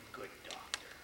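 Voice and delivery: hushed patronizing good doctor; condescending whisper. Retold or imitated. Adjuster patronizing the doctor on the phone, hushed. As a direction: talk down softly. Claims adjuster mocking him.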